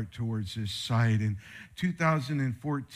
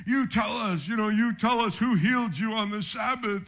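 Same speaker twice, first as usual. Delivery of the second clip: mock religious people; 7 semitones higher than usual. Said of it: "like religious people do".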